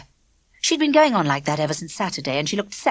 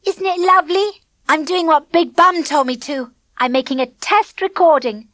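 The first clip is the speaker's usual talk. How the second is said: cheerful; 8 semitones higher, 5 dB louder than usual. A narrator's reading. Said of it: "Denzel gave a cheerful grin".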